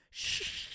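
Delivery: breathy shush to silence someone; imitating mum's shush. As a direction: hush him mid business call. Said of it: "and she just went".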